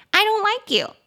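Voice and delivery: put-on high, pouting child; sulky child. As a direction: pout it like a kid. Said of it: "小朋友".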